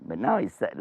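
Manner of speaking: ordinary delivery, nothing marked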